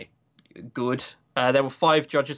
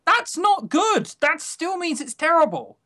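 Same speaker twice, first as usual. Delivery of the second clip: high, slowed, emphatic disbelief; high exasperated protest; incredulous; 13 semitones higher than usual. Real, not acted. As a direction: pitch it way up in disbelief.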